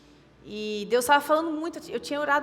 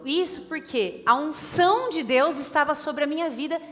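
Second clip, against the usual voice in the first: louder, higher preaching, driving each line home; fervent preaching; 6 semitones higher, 5 dB louder than usual. Acted. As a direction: preach it out with rising fire. Preaching builds to full force.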